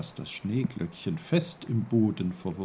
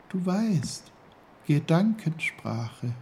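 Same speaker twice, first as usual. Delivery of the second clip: high, small flower voice; high-pitched little character voice; little snowdrop; 8 semitones higher, 3 dB louder than usual. A narrator's reading. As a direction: speak as a tiny snowdrop. Snowdrop speaks.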